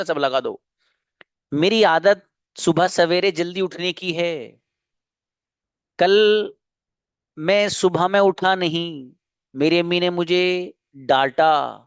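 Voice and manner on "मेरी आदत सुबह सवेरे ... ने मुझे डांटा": deliberate, measured teacher's reading; slow read-out for a class to translate; slow dictation. Shown as a demonstration. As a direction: dictate slowly, pausing between phrases. Dictates it once more for translation.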